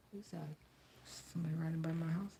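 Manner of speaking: quick hushed whisper, no voice. Real, not acted. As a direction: whisper fast so nobody outside hears. Someone passing outside the house.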